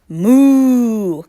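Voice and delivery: long, loud, drawn-out moo; moaning cow. Acted. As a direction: moan it like a cow. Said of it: "cow moaned".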